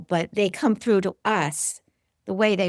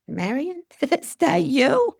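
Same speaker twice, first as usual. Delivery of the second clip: laughing question; 8 semitones higher, slower than usual. Retold or imitated. Asking bird if it's friend.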